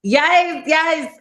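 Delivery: high, eager double yes; excited yes. Real, not acted. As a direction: jump in brightly, thrilled to be asked. Excited answer to socials question.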